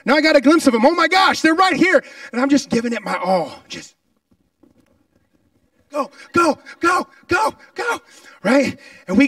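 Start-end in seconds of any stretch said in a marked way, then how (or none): 0.0-2.0 louder and higher, building to panic; rising alarm. Retold or imitated. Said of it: "and it's like". 5.9-8.0 higher, loud chanted go; shouted go. Retold or imitated. Urging himself to the line.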